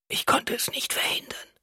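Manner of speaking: frail, whispered confession; weak whisper. A narrator's reading. Wife confesses she could not prevent it, weak whisper. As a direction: whisper it with little strength.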